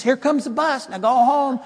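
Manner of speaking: high-pitched imitation of a lady urging; urging dog owner. Elderly lady to dog as bus arrives, urging. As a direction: hurry the dog along in a high voice. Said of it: "And she says".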